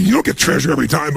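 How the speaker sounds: ordinary talk, nothing marked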